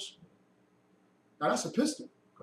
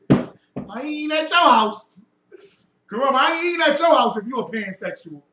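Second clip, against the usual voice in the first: loud refusal; 4 semitones higher, 10 dB louder than usual. Real, not acted. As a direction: shout it in comic outrage. Refusing to visit, outraged.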